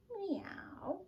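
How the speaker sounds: high kitty meow; playful high meow; cat meow. Shown as a demonstration. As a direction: meow like a cat mid-stretch. Kitty cat stretch together.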